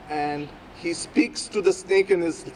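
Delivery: ordinary delivery, nothing marked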